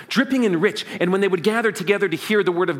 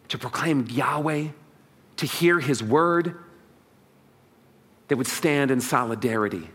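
ordinary delivery

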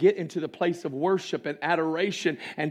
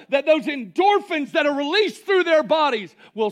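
high, loud urgent preaching; impassioned preaching; 10 semitones higher, 6 dB louder than usual. Real, not acted. Preacher burdened for young people, preaching at full force. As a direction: plead with fire.

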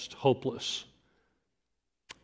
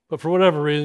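ordinary delivery

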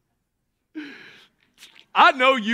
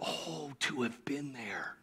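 breathy hushed wish; whispered longing; 12 semitones lower, 20 dB quieter than usual. Retold or imitated. Preacher voicing believers who long to have been there, whispered.

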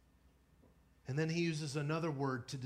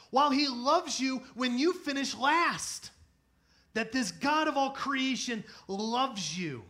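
full-force preaching; 10 semitones higher, 9 dB louder than usual. Acted. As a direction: preach it to the back row.

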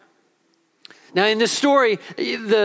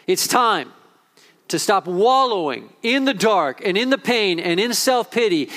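ordinary talk, nothing marked

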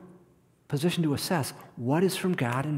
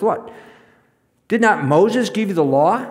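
indignant challenge; 6 semitones higher, 8 dB louder than usual. Retold or imitated. Jesus challenging the crowd.

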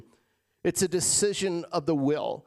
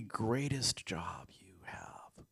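hushed whisper; 7 semitones lower, 10 dB quieter, much slower than usual. Acted. Pastor to the congregation, whispered softly. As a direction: whisper "greatest job you have" slowly and softly.

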